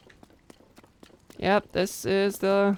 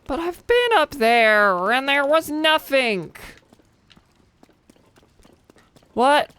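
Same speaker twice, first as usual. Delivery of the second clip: put-on higher, slow, exasperated British accent; hammed-up British outrage, higher and drawn out; mock British accent; 8 semitones higher, 4 dB louder, much slower than usual. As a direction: play an indignant Brit scolding the game. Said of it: "how often I do a British accent".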